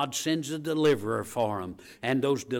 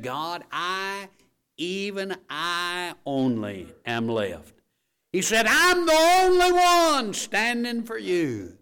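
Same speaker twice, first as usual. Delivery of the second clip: high, slow, loud lament as Elijah; Elijah's lament; 7 semitones higher, 7 dB louder, slower than usual. Acted. Elijah complaining to God that he stands alone, wailing. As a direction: cry it out like a lonely prophet.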